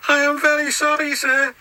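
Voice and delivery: high, put-on engine voice, sorry for himself; a high little engine voice full of self-pity; James the engine, glum. James alone in the shed, high glum engine voice. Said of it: "said James".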